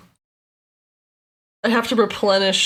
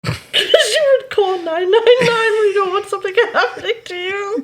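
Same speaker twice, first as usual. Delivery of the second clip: words squeezed out through laughter, pitched up; barely getting it out for laughing; high, breaking laughing speech; laughing while talking; 13 semitones higher, 3 dB louder than usual. Real, not acted. Cracking up over the joke.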